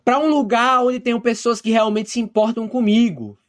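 high, heated mock yell; shouting. Daughter shouting back at her father, high and heated. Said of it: "Ela grita".

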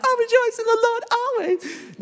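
very high falsetto, mock-blissful; mock gushing. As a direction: parody an over-emotional believer in a high falsetto. Mocking rejoicing as mere feeling.

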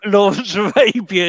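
laughing through the title, loud. Real, not acted. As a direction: burst out laughing while repeating it. Laughing while echoing a film title.

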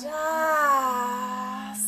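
long loud open sigh. Shown as a demonstration. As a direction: sigh it all out loudly. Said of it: "sigh it out, like, really, like, loud sigh".

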